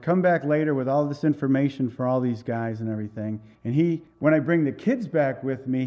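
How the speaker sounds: usual speaking voice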